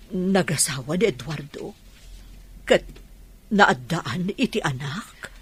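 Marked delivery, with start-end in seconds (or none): none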